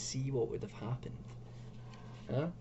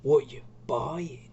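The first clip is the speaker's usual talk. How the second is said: merchant voice; 3 dB louder, much slower than usual. Acted. Opening shopkeeper greeting, game merchant.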